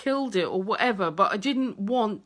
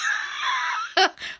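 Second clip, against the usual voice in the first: a high shrieking burst of laughter. Real, not acted. Wife describing husband bent over in boxers, shrieking laugh.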